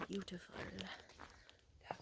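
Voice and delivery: soft hushed whisper. Real, not acted. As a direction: breathe it quietly. Whispering to viewer in pine forest.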